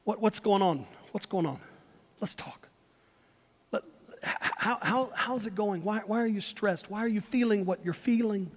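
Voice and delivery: slowed-down parent. Acted. Parent slowing down to ask a stressed kid, gentle and soft. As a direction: ease the kid down, tender and unhurried. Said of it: "slow down and say".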